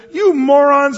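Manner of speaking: loud, high bellow of contempt; angry yell. Retold or imitated. Moses yells at the thirsty people, enraged. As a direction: shout the insult at them. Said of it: "he yells".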